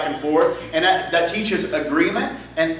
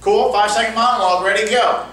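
loud, higher call to the whole room; 5 semitones higher, 4 dB louder than usual. Real, not acted. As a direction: call the group to attention. Coach calling out to the group.

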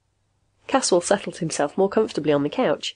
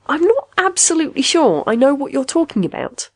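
high-pitched, hesitant admission; confessing; 8 semitones higher, 4 dB louder than usual. A narrator's reading. Patient confesses confusion to machine, higher and unsure. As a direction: admit confusion in a raised voice. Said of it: "Caswell confessed".

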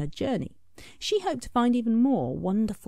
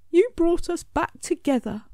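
Smudge grateful; 10 semitones higher, 5 dB louder than usual. A narrator's reading. Smudge voiced.

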